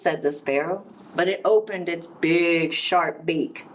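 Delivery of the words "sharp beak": ordinary delivery, nothing marked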